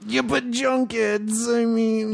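high, drawn-out comic moan; slow, high-pitched playful whine; mock whining. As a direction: complain in a put-on high voice. Mock complaint about press junkets.

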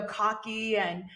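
ordinary talk, nothing marked

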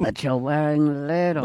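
put-on comic Swedish accent; playful fake Swedish accent.

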